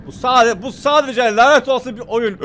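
loud, high, slow emphatic cursing; strained, loud self-reassurance; exasperated outburst. Real, not acted. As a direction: raise your voice, stretching the words in frustration.

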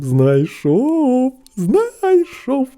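high, drawn-out call; excited shout. A narrator's reading. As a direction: announce your discovery loudly. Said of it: "хлопець вигукнув".

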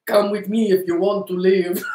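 a hammed-up Terminator line in a mock Russian-sounding accent; Terminator impression. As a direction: deliver it like a bad action-movie cyborg. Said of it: "You all like my impression?".

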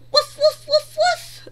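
high, loud playful barking; puppy bark. Acted. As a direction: bark like an excited little puppy. Voicing the puppy Biscuit.

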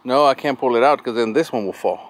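ordinary delivery

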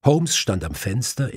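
usual speaking voice

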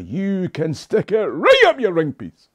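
a shouted comeback to the comment section; defiant retort. Acted. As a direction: fire it back at the critic, hammed-up. Defiant reply to critic.

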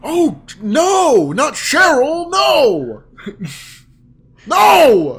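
high, loud hammed-up wailing; mock distraught Luigi. Voicing Luigi crying for Cheryl, high hammed-up wailing. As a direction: wail like a dramatic cartoon hero. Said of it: "For the rest of the game".

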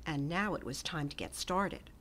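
usual speaking voice

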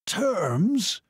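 high, rasping incredulous question; rasped. A narrator's reading. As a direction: rasp it out high, rising at the end. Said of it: "he rasped".

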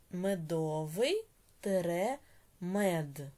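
each word drawn out slowly for writing; slow dictation. Shown as a demonstration. Teacher dictating to pupils, very slowly. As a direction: spell it out slowly so the class can write it down. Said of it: "teacher dictates word by word".

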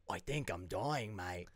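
low, slow, soft mock Australian; dying Australian bandmate. Matt in the biopic, dying, low Australian voice. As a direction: sink into a feeble Australian confession.

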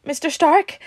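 high, loud, frightened question; gasping. A narrator's reading. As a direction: gasp out a plea. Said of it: "Peter gasped".

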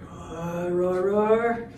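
soft, low dinosaur rumble; quiet roaring. Acted. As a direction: roar low under your breath. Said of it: "gonna be lots of roaring".

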